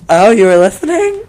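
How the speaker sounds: shocked high squeal; surprised. Real, not acted. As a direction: caught out, loud and high. Caught out, mock shock.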